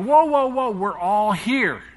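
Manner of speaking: high, loud whoa, then a slow reassurance; urgent calling out. Retold or imitated. Paul stopping the jailer from killing himself, urgent high call.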